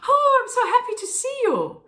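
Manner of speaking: light happy hello to a friend. Shown as a demonstration. Showing a spouse greeting, high and happy. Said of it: "a very sort of light, happy sort of sound".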